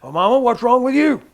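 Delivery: big loud mock preacher boom, rising in pitch; imitating Jack Coe. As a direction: boom it out like a revival healer. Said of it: "Jack Coe would take that microphone and go".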